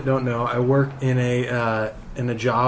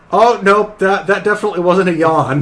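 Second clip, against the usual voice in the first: louder, higher embarrassed coworker covering a fart; coworker caught out; 6 semitones higher, 8 dB louder than usual. Retold or imitated. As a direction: play the sheepish coworker loudly owning up. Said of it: "we hear him go".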